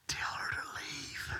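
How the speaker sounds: slow unvoiced whisper. Pastor answering the caller, slow whisper. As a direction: whisper the instruction slowly.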